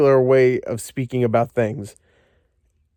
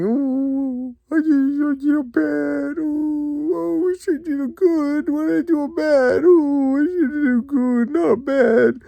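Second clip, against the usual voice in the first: very high, babyish whimper with fake crying; mock the sad hero as a whining child; high hammed-up sobbing baby voice; whiny mock Indy; 13 semitones higher, slower than usual. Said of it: "He's all alone, he's sad".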